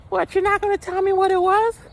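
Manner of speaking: high mock falsetto; whiny squeaky impression of her; imitating his date. Date pressing him about the seasoning, in a high mock falsetto. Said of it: "she's like".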